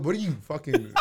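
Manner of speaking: ordinary talk, nothing marked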